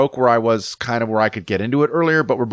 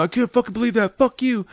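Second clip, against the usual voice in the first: angry rant; 11 semitones higher than usual. Retold or imitated. Angry surfer keeps yelling.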